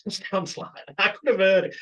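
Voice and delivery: usual speaking voice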